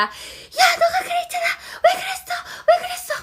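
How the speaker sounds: high angry yelling at a friend; acted shouting. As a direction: scream the accusation in a rage. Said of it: "어, 소리치다입니다". (화가 나서 소리치는 목소리)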